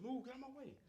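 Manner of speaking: high, urgent yell to clear a path; shouted command. Acted. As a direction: shove through the crowd, yelling. Deku pushing through crowd.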